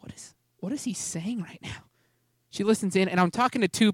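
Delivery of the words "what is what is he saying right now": hushed, puzzled whisper; imitating a mom's baffled whisper; whispered wondering. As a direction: whisper it like someone eavesdropping at a door. Mom eavesdropping at the door.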